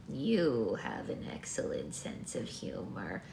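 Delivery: put-on low, slow old woman; grandma voice. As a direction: compliment slowly in an aged voice. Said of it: "this is just the regular grandma voice".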